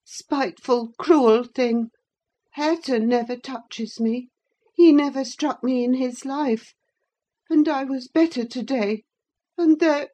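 put-on high, complaining boy's voice; aggrieved boy Linton. A narrator's reading.

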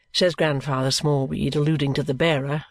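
usual speaking voice

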